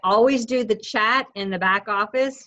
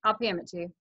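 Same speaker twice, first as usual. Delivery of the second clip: soft, bored mumble; softer, offhand brush-off; unexcited reply; 4 semitones lower, 7 dB quieter, much faster than usual. Retold or imitated. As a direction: say it like you couldn't care less. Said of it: "And you're like".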